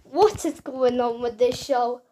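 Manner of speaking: usual speaking voice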